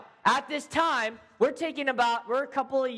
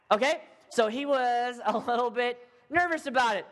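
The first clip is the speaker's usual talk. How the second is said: ordinary talk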